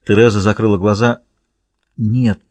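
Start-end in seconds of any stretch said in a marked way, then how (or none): none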